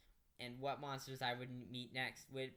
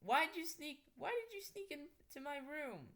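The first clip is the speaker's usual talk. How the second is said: high, pressing why; lightly voiced questioning; 13 semitones higher than usual. Girl questions the vampire, high and pressing. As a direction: demand an answer.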